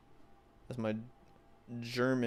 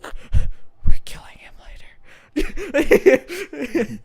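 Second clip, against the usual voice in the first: wheezing, whispery laugh through the words; breathless laughing; 14 dB louder, much slower than usual. Real, not acted. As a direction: barely get it out for laughing. Cracking up at the joke.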